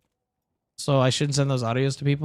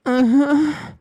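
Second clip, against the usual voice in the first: a short hammed-up moan, higher and louder; mock moan; 8 semitones higher, 4 dB louder than usual. Streamer mimics a random moan for chat, mock moan. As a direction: fake a silly moan for the chat. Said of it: "Like that?".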